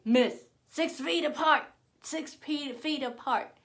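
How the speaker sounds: high, loud mimicked order; mean, obnoxious shout. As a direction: imitate a rude cashier yelling at a customer. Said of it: "he was mean, rude, and obnoxious".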